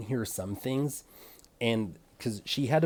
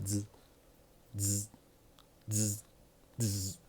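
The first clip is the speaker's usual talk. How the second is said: soft buzzing clipper noise through the teeth; 6 dB quieter, slower than usual. Retold or imitated. To viewers, mimicking the stylist's clippers with a soft buzz. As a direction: hum it like an electric trimmer, quietly.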